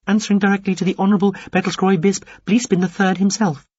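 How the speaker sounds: high, thin, formal alien voice; a prim little alien addressing him; small alien voice. A narrator's reading. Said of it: "it told him".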